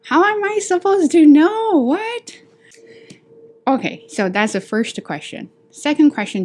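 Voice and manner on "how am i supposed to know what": exasperated outburst. Acted. Exasperated outburst over coffee options.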